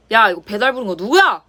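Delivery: loud, furious call to the staff; angry yell. Retold or imitated. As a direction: bark it out like a boss who has caught a mistake. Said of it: "화내는 거예요".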